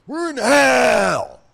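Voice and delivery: Norm Macdonald. Acted. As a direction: bellow it slow and high, as an impersonation. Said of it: "best Norm Macdonald impersonation".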